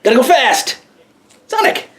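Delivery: high excited cartoon shout; Sonic catchphrase. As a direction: yell it like the hedgehog.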